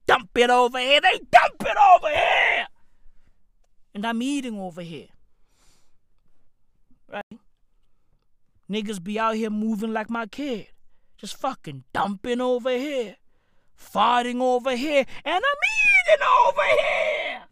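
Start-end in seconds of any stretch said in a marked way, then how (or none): 1.0-2.7 ranting shout. Real, not acted. Ranting, voice jumps up. 15.3-17.4 much higher, loud indignant yell; outraged shout. Real, not acted. Peak of rant.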